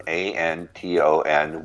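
letter by letter, slowly and clearly; spelled out slowly. Shown as a demonstration. Spelling the name slowly for the host, letter by letter. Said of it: "What's the author's name?".

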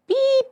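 a high, sharp bleep sound in place of a swear word; mock censor bleep. Friends call her an absolute beep.

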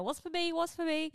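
imitating an excited kid; excited little kid. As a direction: beg like an eager small child. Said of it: "all excited, like".

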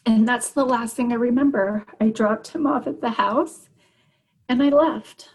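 high, strained, on the edge of crying; tearful. Real, not acted. Mother telling how she left her son, tearful. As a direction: get through the account while fighting real tears.

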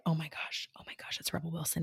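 hushed, breathless whisper; starstruck whisper. Retold or imitated. Fan whispering on spotting Rebel Wilson backstage, starstruck whisper.

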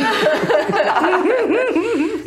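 loud high laugh; high burst of laughter. Real, not acted. Big laugh.

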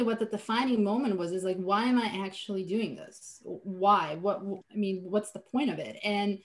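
ordinary talk

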